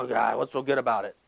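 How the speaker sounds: low, subdued grouchy reply; grumpy. Retold or imitated. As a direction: imitate a grouch, dropping low and soft. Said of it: "Grumpy, grumpy, grumpy".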